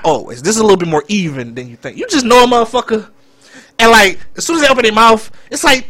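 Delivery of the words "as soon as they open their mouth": normal talking voice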